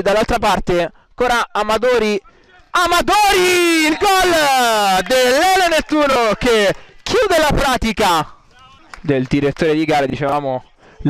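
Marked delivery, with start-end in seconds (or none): 2.7-8.4 excited goal call. Real, not acted. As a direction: stretch the clinching goal. Decisive goal called.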